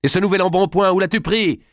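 high, loud accusing shout; angry cry. A narrator's reading. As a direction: shout the accusation at your daughter. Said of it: "cria Dame Eliabel".

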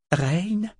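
high, hopeful calling out. A narrator's reading. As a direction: call out hopefully into the night. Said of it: "L'espoir qui vibrait dans sa voix".

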